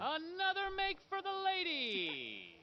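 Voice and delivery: high, long-drawn score call; soaring showman's cry; carnival barker. Acted. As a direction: hold the words out like an announcer.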